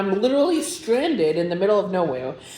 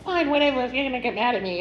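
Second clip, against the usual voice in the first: high-pitched, petulant dismissal; huffy comeback in a retold argument; bitchy; 6 semitones higher, faster than usual. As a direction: act out a bitchy retort, pitched up. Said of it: "I kind of start being bitchy".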